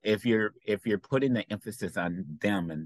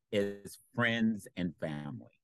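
ordinary talk

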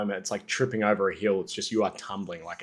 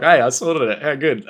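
cocky tradie; 6 semitones higher, 11 dB louder than usual. Retold or imitated. Cocky tradie voiced in story.

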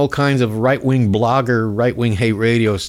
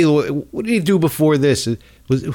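usual speaking voice

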